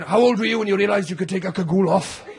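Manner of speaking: ordinary talk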